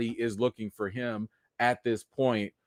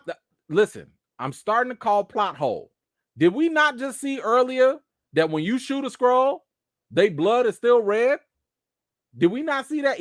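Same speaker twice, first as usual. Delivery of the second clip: 1 incredulous; 9 semitones higher than usual. Real, not acted. Ranting about plot hole.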